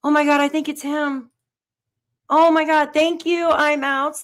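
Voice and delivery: high, loud burst of real excitement; excited gasp. Real, not acted. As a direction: gush with sudden thrill. Viewer sends sticker, news breaks.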